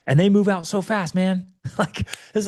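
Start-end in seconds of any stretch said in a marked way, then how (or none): none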